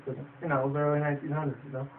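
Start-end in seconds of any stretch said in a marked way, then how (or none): none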